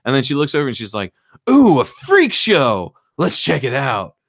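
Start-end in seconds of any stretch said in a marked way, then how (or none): 1.5-4.1 high, loud, drawn-out delight; gushing, hammed-up discovery; mock excitement. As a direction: imitate someone thrilled by a roadside attraction. Character spots an exciting sign.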